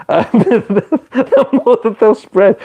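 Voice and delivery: high, slow, laughing through the words; cracking up while repeating his point. Real, not acted. Streamer repeats his point to chat, laughing.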